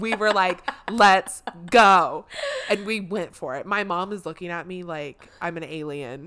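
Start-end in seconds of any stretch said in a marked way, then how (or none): 0.9-2.2 hype shout. Retold or imitated. Hyping the stadium crowd.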